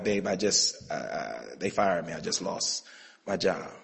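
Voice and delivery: soft, low, breaking telling his wife; almost whispered bad news; hushed admission to his wife; quiet, defeated confession. Telling wife he was fired.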